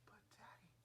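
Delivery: a hushed, sweet "But Daddy"; whispered wheedling daughter. Retold or imitated. Daughter whispering to soften her father. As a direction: coax him in a whisper.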